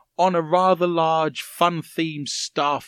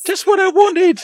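mock delight; 9 dB louder than usual. Fake thanks for dead shark.

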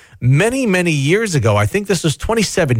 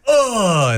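death groan; 6 semitones higher than usual. Pretend. Chicken dying.

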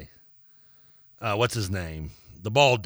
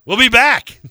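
loud, high-pitched call-out; shouted sign-off; 12 semitones higher, 11 dB louder, slower than usual. Real, not acted. Host throwing to the break, shouted sign-off. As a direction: belt it out to close the segment.

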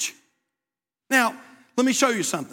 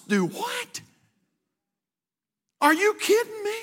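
high-pitched, mock-incredulous disbelief; 10 semitones higher than usual. Preacher recalling reaction to someone's deathbed plan, high incredulous. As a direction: act out being utterly taken aback, voice shooting up.

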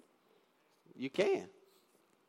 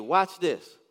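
ordinary talk, nothing marked

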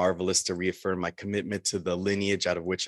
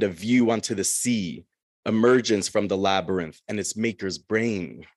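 steady, louder poem reading voice; poetry reading; 3 semitones higher, 6 dB louder than usual. Acted. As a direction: read the poem to the room. Reads Minotaur poem aloud.

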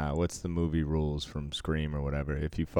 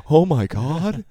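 gasped, loud high-pitched awe; retold jaw-drop, pitched way up; mock amazement; 11 semitones higher, 9 dB louder, slower than usual. Recalling seeing nudity in Revenge of the Nerds, mock amazement.

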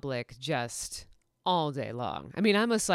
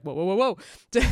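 mock startled whoa; 3 semitones higher, 6 dB louder than usual.

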